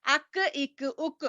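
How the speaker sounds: "اک" with a light k further forward in the mouth; kaf drill. Shown as a demonstration. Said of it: "اب ویسے کاف کو یاد کرنا ہے".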